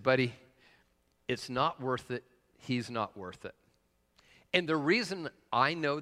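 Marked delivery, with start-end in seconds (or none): 0.0-3.5 gentle calming. Retold or imitated. Calming the bristling man gently.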